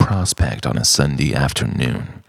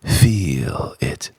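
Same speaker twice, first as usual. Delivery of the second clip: hushed, lower whisper; 4 semitones lower than usual. Acted. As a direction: whisper it like a secret. Whispered sensory instruction.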